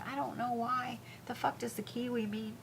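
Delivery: unvoiced whisper to herself; whispered aside. Real, not acted. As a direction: breathe it out like a private aside.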